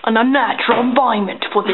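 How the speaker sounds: brisk mock-Australian nature narration; bad Australian accent. Narrating the creature's environment, bad Australian accent. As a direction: play a wildlife host, badly faked Australian. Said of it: "Your Australian accent is horrible".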